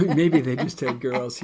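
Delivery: loud, amused laugh through the words; laughing agreement. Real, not acted. Laughing at co-host's joke.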